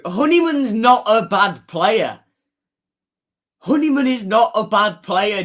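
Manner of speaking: high, loud insistent defence; emphatic protest. Real, not acted. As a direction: insist hard he is not bad. Protesting a chat comment.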